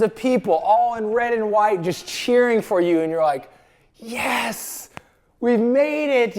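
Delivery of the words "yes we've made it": higher, triumphant retold cheer; ecstatic. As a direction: celebrate as if just crossing into the stadium. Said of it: "very ecstatic feeling there".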